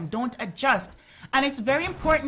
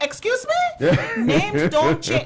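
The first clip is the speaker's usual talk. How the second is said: a mother's outraged disbelief, pitched high; indignant excuse me; 8 semitones higher, 7 dB louder than usual. Retold or imitated. Mother retells scolding her kids, high and indignant. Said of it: "And I'm like".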